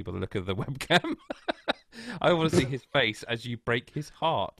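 usual speaking voice

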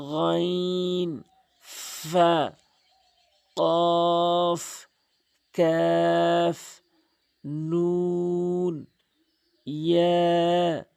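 slow chanted letter names; letter recitation drill. Shown as a demonstration. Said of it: "এভাবে আপনি বারবার পড়বেন".

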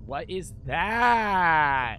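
loud, drawn-out cry of alarm; alarmed outcry. Real, not acted. Streamer to chat on spotting something creepy, alarmed. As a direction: stretch it out loud in disbelief.

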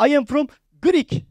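imitating heavily Turkish-accented English, slow and high; high, drawn-out mock Turkish-accented English; thick Turkish accent. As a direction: blurt out broken English in a strong Turkish accent. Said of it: "Sadece aksanı var".

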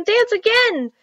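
put-on high, loud children's cheer; excited cry. A narrator's reading. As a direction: call out eagerly, voice way up. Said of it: "cried the children".

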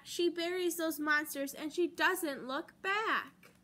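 usual speaking voice

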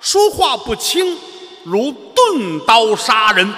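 slow, loud, high proclamation of a teacher's saying; the old teacher's saying, declaimed slowly and loud; teacher's maxim. Retold or imitated. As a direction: boom it out like an old master's rule. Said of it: "当初老师说了".

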